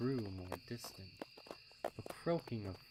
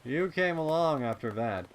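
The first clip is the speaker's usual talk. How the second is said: colt voice; 7 semitones higher, 13 dB louder than usual. Acted. Snails answering.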